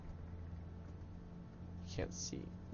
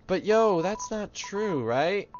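higher voice, hesitant and seeking reassurance; doubtful kid character; 6 semitones higher, much slower than usual. Acted. Kid asking player for denial, doubtful voice.

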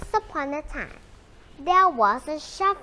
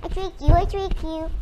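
higher, sing-song teasing; mocking laughter; 4 semitones higher than usual. A narrator's reading. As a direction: gloat like a child who fooled everyone. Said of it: "The boy laughed at them".

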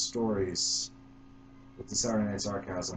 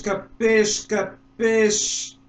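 put-on Italian accent; 12 semitones higher, 10 dB louder, much slower than usual. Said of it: "the Italian hand talk".

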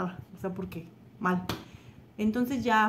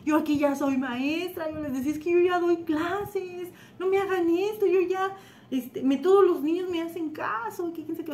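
mock crying; 8 semitones higher, 3 dB louder than usual. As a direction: wail it like a pleading little kid, imitated. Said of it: "Yo lloraba y decía". (lloriqueo agudo de niña, imitado)

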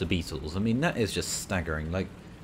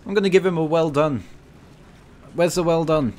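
loud, raised, excited voice; excited praise; 6 semitones higher, 7 dB louder than usual. Real, not acted. Player hunting for the well-done message for his saviour, excited. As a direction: keep cheering, high and loud. Rushing to congratulate teammate.

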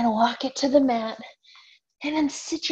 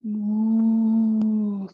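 playful moo; cow moo; 3 dB louder than usual. Pretend. Teacher mooing for cow pose. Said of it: "Cow pose?".

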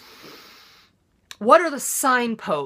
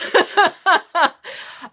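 loud real laugh at herself; burst of laughter; 10 semitones higher, 6 dB louder than usual. Real, not acted. Laughing at herself over the statement haircut.

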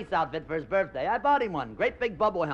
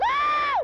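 very high, loud shriek; a shrill squeal from the new bride; 6 dB louder than usual. Retold or imitated. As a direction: scream it like a thrilled bride. Bride's reaction.

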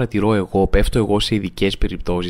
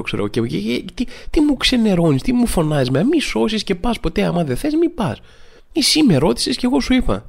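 exasperated retort; 6 semitones higher than usual. Retold or imitated. Indignant retort to the granny.

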